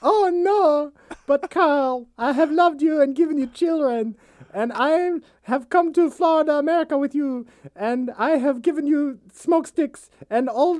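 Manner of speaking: high-pitched pleading wife voice; hammed-up high-voiced wife wailing; Doris pleading. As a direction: beg him in a high wounded voice.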